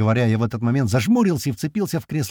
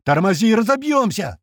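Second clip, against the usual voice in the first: loud, high alarmed cry; panicked scream; 9 semitones higher, 3 dB louder than usual. A narrator's reading. Passenger fearing a crash, panicked shout. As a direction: shout in panic, pitch climbing. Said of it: "закричал Слива".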